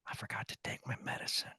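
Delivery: whispered confession in church. Retold or imitated. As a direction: lean in and whisper it. Grandma leans over in church.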